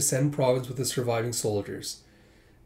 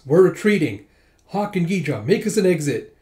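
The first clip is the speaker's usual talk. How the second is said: higher, louder order to her men; firm command; 5 semitones higher, 6 dB louder than usual. Acted. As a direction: call the retreat with authority. Princess commanding a retreat.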